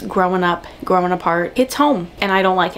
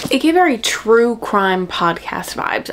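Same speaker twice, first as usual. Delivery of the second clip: ordinary delivery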